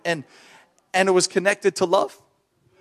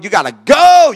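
loud, higher burst of authority; forceful retold exorcism order; commanding deliverance shout; 8 semitones higher, 10 dB louder than usual. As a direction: drive the sickness out. Retold command casting out infirmity.

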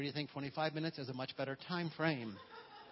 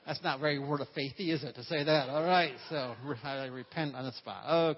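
laughing, louder self-mocking aside; 7 dB louder than usual. Real, not acted. Preacher laughing at own joke to congregation. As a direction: laugh off the slip and repent.

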